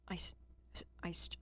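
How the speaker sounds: stammering on the first sound; catching on the s, can't get it out; stutter. Real, not acted. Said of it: "I'm gonna stutter".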